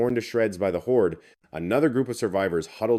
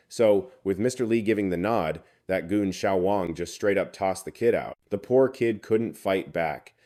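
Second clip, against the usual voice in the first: ordinary delivery, nothing marked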